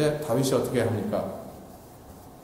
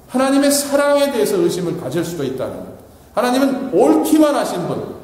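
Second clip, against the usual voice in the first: fervent preaching; 5 semitones higher, 3 dB louder than usual. Acted. Pastor urging the congregation, in fervent preaching. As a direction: press the point home from the pulpit.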